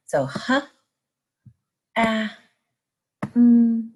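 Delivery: "ha", "ah", held "mm" said apart; segmented sounds. Shown as a demonstration. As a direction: stretch each sound of ham. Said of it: "blend the sounds in ham".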